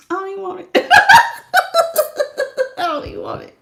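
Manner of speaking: half-laughing, half-whispered brush-off; mock no thanks while cracking up; laughing mock refusal.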